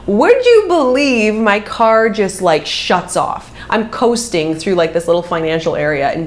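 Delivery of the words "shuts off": normal talking voice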